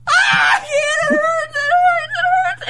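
very high, loud yelping cries of pain; hammed-up pained cry. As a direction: cry out as the jaw hurts. Retold pain while opening mouth.

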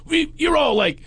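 hammed-up accusing psychic; mock outrage. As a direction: scoff it out like a scandalized critic. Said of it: "he goes".

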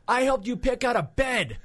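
high, slow, laughing through the lame comeback; laughing feeble comeback. Retold or imitated. Retelling his lame comeback to the angry comic, laughing. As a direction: squeak it out, cracking up at yourself. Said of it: "this is what came out of my mouth".

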